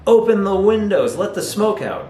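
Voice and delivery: high, urgent mock shout; demonstrated shout. Shown as a demonstration. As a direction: call out a warning to a friend, pitched well up. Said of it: "I would shout to my friend".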